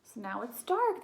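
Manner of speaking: usual speaking voice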